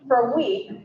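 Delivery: ordinary talk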